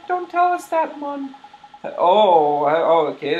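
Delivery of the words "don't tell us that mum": put-on high-pitched old-lady voice; high falsetto granny read; wrong character's voice. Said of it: "that's drippy".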